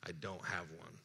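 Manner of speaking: ordinary talk, nothing marked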